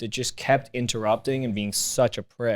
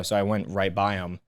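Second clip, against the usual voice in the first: ordinary talk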